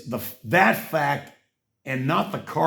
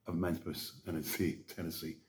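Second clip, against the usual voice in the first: much softer and lower; fading mumble; 10 semitones lower, 17 dB quieter than usual. Real, not acted. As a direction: let it trail away. Fading after misreading.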